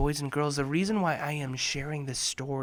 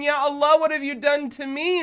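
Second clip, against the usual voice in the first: complaining cry; 9 semitones higher than usual. Acted. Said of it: "I am complaining".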